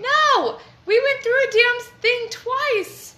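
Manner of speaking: high, loud indignant outburst; loud protest. Real, not acted. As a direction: shout it down, outraged. Protests the partner's defence.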